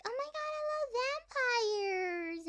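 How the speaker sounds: high, mock-gushing squeal; mock fangirl gush. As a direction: imitate a fan squealing over vampires. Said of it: "and they're like".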